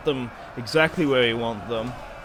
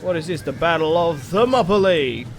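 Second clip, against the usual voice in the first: excited; 5 semitones higher, 4 dB louder than usual. Real, not acted. Big enemy charge in battle.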